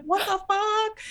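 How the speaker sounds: very high-pitched stunned outcry; mock shock. Heroine trapped by force field.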